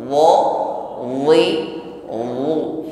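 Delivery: "wa, wi, wu" with heavy dh onsets; "wu" as an emphatic, non-hissing zha; thick zha. Shown as a demonstration. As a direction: thicken each of "wa, wi, wu". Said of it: "Tebalkan pengucapan huruf".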